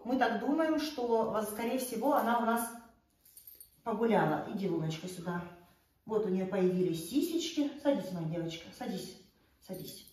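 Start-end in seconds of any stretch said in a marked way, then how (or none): 4.5-5.4 soft, low coaxing to a pet; talking to a dog. Real, not acted. 7.8-10.1 soft low commands to a pet; talking to a dog. Real, not acted.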